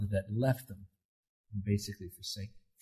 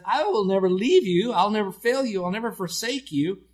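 higher, louder impassioned promise; fervent preaching; 8 semitones higher, 4 dB louder than usual. Acted. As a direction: lift the promise with conviction. Preacher voicing God's promise.

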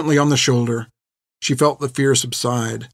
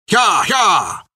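high shout at the horses; urging shout; 7 semitones higher than usual. A narrator's reading. Bartimaeus driving the horses away from gunfire, shout. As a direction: drive the horses on hard.

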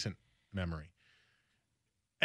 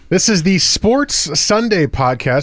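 announcer; 3 semitones higher, 17 dB louder than usual. Acted. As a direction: read the sponsor tag big. Host reading sponsor intro.

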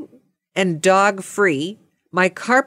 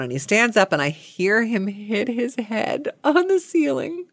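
normal talking voice

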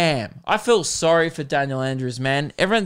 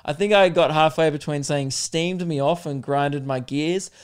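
ordinary delivery

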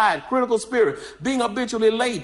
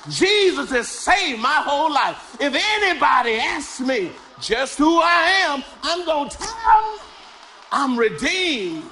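full-force preaching; 6 semitones higher than usual. Acted. Pastor testifying his redemption to the church, shouting. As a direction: preach it to a climax.

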